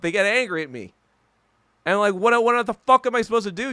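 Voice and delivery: ordinary talk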